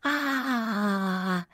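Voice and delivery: long, muted, wavering "aaah"; panicked wail. Acted. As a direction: let out a stretched wail of dismay. Classmate panics about dictation.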